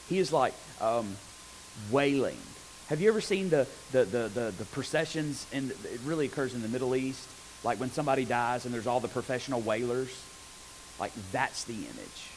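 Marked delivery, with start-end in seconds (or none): none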